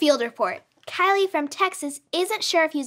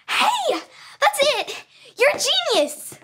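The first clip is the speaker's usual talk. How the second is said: higher-pitched burst of discovery; excited realization; 10 semitones higher than usual. Acted. Friend struck by a talent show idea, excited exclamation. Sudden idea after practising.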